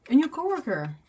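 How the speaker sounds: high, loud delighted squeal; excited. Real, not acted. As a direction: burst out with pure joy. Delighted reaction to farm cat.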